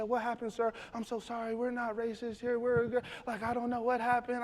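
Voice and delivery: hushed, high apology; apologetic manager. Retold or imitated. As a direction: imitate a manager soothing an offended customer.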